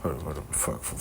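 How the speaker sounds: ordinary delivery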